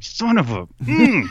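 mock seething, cutting the swear short; retold stifled outburst; frustrated, bitten-off curse.

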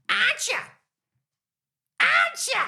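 very high squawked echo; put-on voice. Shown as a demonstration. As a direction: squawk it in a piercing falsetto. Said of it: "Now, I'll do the voice".

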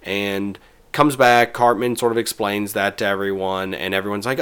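normal talking voice

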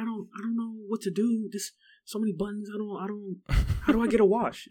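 high, fretful bewildered voice; confused customer. Retold or imitated. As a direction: play a lost, flustered old customer. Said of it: "they're like".

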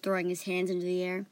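ordinary delivery, nothing marked

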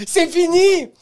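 high, loud, mock-solemn proclamation; shouted announcement. Announcing a rule to his girlfriend, loud shouted proclamation. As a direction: proclaim the new rule at full voice. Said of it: "J'ai annoncé à ma blonde".

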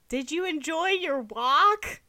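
high, loud and drawn-out mom impression; hammed-up mom asking, high and slow; mock mom voice. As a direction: stretch it out loudly as an exaggerated mother. Mocking voice for the mom.

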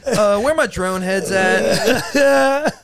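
laughing mock call. Jokey mock call, laughing, slow.

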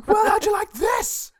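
high shrieking laugh-through line. Retold or imitated. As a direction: scream it while cracking up. Mock villain line, laughing.

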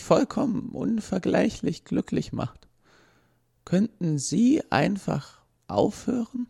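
patient machine stating a simple truth, slowing at the end; robot asks the policeman, a bit fed up; weary robot. Acted. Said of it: "In einem Tonfall, der nicht unhöflich war, aber etwas überdrüssig".

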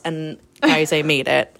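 ordinary delivery

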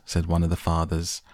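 plain reading voice

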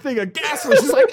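ordinary delivery

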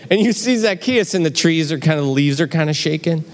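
usual speaking voice

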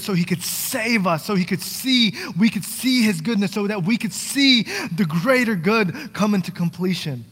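loud, high, ringing preacher's cry; preaching at full force. Acted. Pastor urging his church, full-force preaching. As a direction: lift the church up with it.

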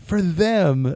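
animated movie quote; acted punchline reply. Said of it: "he's like".

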